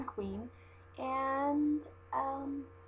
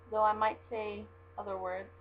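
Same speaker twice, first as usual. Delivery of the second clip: ordinary delivery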